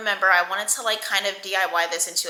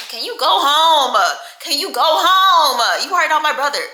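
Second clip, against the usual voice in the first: exasperated, higher and louder, pleading him to leave; fed-up teen telling big cousin to go home; annoyed; 7 semitones higher, 7 dB louder than usual. Retold or imitated. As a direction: shoo off an overprotective cousin. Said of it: "And I'm like".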